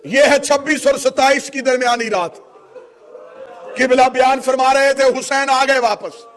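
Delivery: high, loud, impassioned mourning oratory; full-force lament preaching. Real, not acted. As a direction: cry out the tragedy to a grieving crowd.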